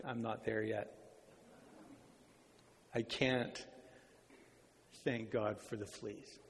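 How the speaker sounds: low, hushed, halting refusal; reluctant. Acted. Corey tells her sister she cannot be thankful, low and reluctant. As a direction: admit it softly with long hesitations.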